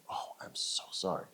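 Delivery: whispered apology; embarrassed whisper. Retold or imitated. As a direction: breathe the sorry under your breath. Sweater lands on floor, embarrassed.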